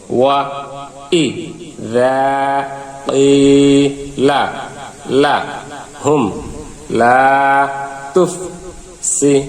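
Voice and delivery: one syllable at a time with long gaps; slow syllable-by-syllable drill; broken syllables. Shown as a demonstration. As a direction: say each syllable separately, pausing between. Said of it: "আমরা এখন ভাঙা ভাঙা উচ্চারণ করব".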